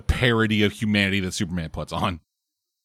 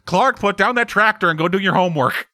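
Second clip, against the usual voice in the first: higher-pitched, hammed-up parent giving orders; scolding farm parent; 8 semitones higher, 3 dB louder, faster than usual. Parent ordering Clark to do his homework, hammed-up scolding. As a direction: put on a nagging parent voice.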